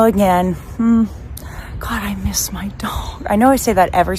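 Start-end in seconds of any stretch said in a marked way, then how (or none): 1.8-3.2 wistful whisper. Real, not acted. Wistful aside at empty dog park.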